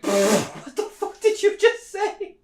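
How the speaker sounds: very high, slow, drawn-out shocked squeal; hammed-up shocked coworkers; mock disbelief. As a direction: stretch it in disbelief. Coworkers shocked at racist joke.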